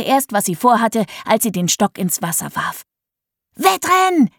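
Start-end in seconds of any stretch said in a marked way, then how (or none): 3.5-4.3 put-on high, bright call of a girl starting a race; excited shout. A narrator's reading. Millie calling the family to race, high excited shout. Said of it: "rief sie".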